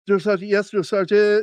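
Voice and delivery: high, stiff military response; imitated nervous recruit; recruit answering sergeant. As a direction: answer rigidly at attention. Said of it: "And I went".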